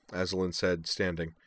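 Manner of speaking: ordinary delivery, nothing marked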